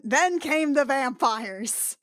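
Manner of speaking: slow, loud mock-ominous punchline; mock-dramatic reveal, half laughing. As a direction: draw it out for comic drama.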